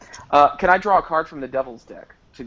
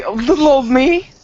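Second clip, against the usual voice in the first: high, loud, put-on coy surprise; mock-modest character; 12 semitones higher, 7 dB louder than usual. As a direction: play flattered innocence, pitched way up. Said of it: "She would say".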